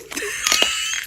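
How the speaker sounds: shrieking giggle that shoots up high; giddy squeal of a laugh. Real, not acted. Laughs after saying I love you.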